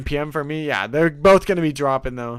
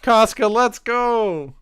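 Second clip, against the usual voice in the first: higher, louder and slow cheers; excited cheering; 9 semitones higher, 5 dB louder, much slower than usual. Real, not acted. Cheering favorite characters during the episode. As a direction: hype them up like a fan.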